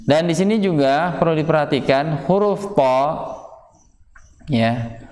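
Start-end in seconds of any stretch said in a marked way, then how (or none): none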